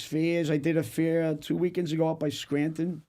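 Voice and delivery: ordinary talk, nothing marked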